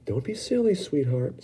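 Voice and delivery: put-on a mother humouring her child; Mrs Salt. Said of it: "said Missus Salt".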